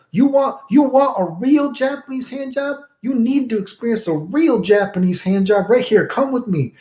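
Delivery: high put-on accented old lady voice; old Chinese lady.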